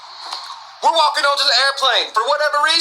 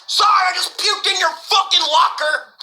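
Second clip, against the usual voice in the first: frantic shout; 7 semitones higher than usual. Retold or imitated. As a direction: yell through the door, mortified. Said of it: "I'm like".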